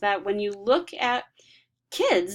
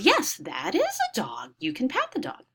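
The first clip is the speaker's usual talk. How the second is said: praising parent; 7 semitones higher than usual. Retold or imitated. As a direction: play the pleased parent answering the child. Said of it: "The parent responds".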